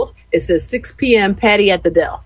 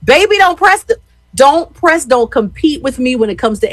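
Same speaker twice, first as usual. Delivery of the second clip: mock warning; 8 semitones higher, 3 dB louder than usual. Playful warning to a rival singer.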